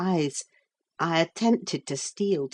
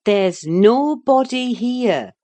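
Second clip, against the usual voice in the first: insisting; 6 semitones higher, 8 dB louder, slower than usual. A narrator's reading. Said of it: "I insisted".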